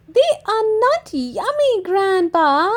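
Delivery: high, quick little piglet voice; Peppa correcting. Acted. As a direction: set grandpa straight like a child.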